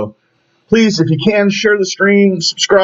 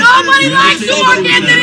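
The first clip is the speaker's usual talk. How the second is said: high raised-voice jeer; angry taunt; 10 semitones higher than usual. Real, not acted. Protester taunts Mark Anthony in a street row, high and angry. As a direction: throw it at him over the argument. Bystander scolds man in row.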